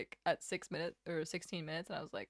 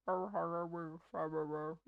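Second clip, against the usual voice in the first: lower, mushy alien croak; garbled Stitch voice; 4 semitones lower than usual. Shown as a demonstration. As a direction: mumble it like Stitch. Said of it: "wouldn't even be able to tell what I was saying".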